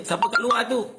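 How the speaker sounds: high, loud call from inside the house; householder calling out. Retold or imitated. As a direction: call out to ask who is at the door. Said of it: "ditanya oleh tuan rumah". (tuan rumah bertanya dengan suara tinggi dan kuat)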